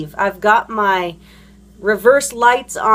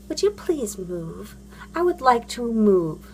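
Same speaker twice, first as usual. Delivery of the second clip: mock politeness; 6 dB quieter than usual. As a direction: ask meekly, as you never really would. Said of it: "not the most polite person".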